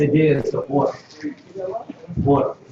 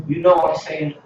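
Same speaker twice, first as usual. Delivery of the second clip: ordinary talk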